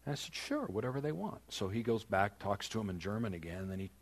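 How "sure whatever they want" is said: softly agree, a little high; quiet, offhand yes; easygoing agreement. Retold or imitated. Guest preacher agrees to speak briefly, quiet and easygoing. Casual agreement to Marcus's first request.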